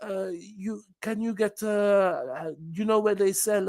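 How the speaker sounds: a hint of a lost tourist stumbling over words; lightly voiced faltering Brit asking directions; fumbling melting accent. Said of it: "my accent just starts melting".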